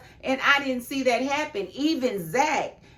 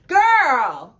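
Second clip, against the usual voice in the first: exasperated exclamation; 7 dB louder than usual. Real, not acted. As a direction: call it out like you can't believe it. Exasperated reaction to the plea.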